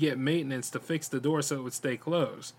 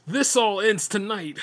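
fierce cry; 7 semitones higher, 6 dB louder than usual. A narrator's reading. Brian attacks the monster.

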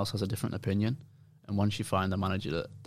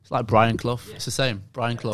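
louder, Clough said Cluff like Brian Clough; firm correction; 3 semitones higher, 8 dB louder than usual. Real, not acted. Player loudly correcting the host on his name. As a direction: insist on the name, Clough rhymes with rough. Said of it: "pronounce it like that".

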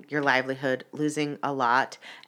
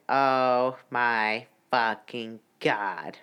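low, drawn-out disbelief; slow-motion horror at what you see; stunned dismay; 5 semitones lower, much slower than usual. Retold or imitated. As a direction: let each word drop slowly, appalled. Stunned reaction on seeing the car.

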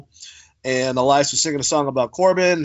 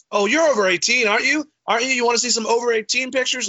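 taunting; 9 semitones higher, faster than usual. Retold or imitated. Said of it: "just being a fucking dick".

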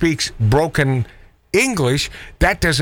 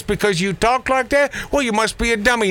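higher-pitched, scornful mock judgement; mocking the left; 8 semitones higher than usual. Host imitating the left judging accents, mocking. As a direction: put on a sneering accuser, quicker at the end. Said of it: "the left loves to label people".

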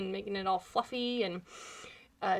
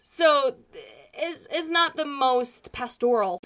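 ordinary delivery

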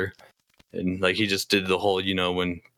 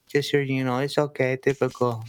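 higher, light imitation of a customer's broken English; customer's broken English, lightly mimicked; imitating broken English; 5 semitones higher than usual. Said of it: "he didn't speak, like, English very good".

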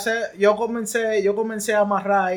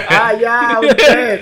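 laughing; 4 semitones higher, 9 dB louder, much slower than usual. Real, not acted. Laughs upon understanding the question.

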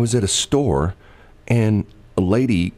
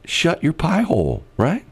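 teasing; 10 semitones higher than usual. Acted. Jokingly telling her to shut up.

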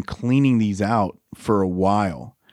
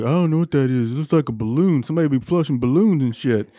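higher-pitched mock innocence; feigned ignorance; 4 semitones higher than usual. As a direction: play dumb to dodge the blame. Playing dumb to angry dad.

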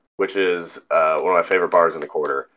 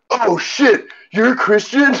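much higher, squeaky startled bartender; mock surprise; slower than usual. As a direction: play the bartender caught out, voice jumping up. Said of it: "He's like".

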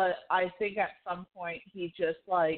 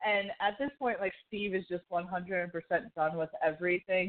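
ordinary talk

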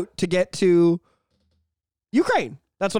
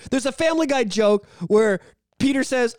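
ordinary talk

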